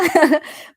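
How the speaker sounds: choked-up little laugh; teary laugh. Real, not acted. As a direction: laugh through the tears. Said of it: "trying not to cry".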